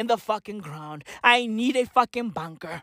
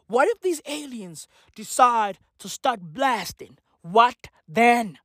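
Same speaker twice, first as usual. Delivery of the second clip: usual speaking voice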